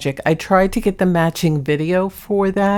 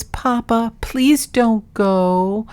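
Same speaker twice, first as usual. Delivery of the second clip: high, very slow drawn-out plea; pleading cat voice; 5 semitones higher, much slower than usual. Retold or imitated. Owner voicing her cat pleading with papa, slow high plea. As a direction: stretch it slowly like a lonely pet. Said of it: "He goes".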